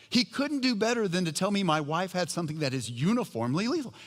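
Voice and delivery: loud, high, laughing through the words; cracking up at the absurd diagnosis. Real, not acted. Couple laughing at grim diagnosis.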